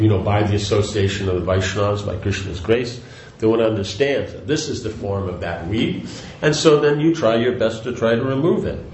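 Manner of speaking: ordinary delivery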